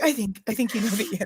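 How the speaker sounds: half-laughing, hushed therapist line; a light imitation of a gently knowing therapist; soft knowing therapist. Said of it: "It's like".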